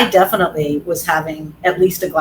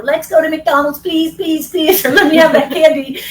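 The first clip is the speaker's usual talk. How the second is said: put-on much higher, whiny-kid pleading; begging little kid; 9 semitones higher, 5 dB louder than usual. Kids pestering a parent for fast food and candy, mock begging. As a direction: beg like a child who won't let up. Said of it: "little kids begging you".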